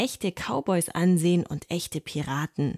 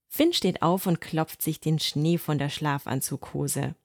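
ordinary delivery, nothing marked

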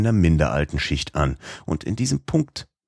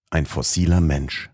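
plain reading voice

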